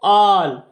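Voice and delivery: "आल" with a long open aa; long aa sound. Shown as a demonstration. Teacher showing pupils the big आ sound in "आल", long aa. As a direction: draw out the aa in "आल". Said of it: "आ की sound निकाल रहा है".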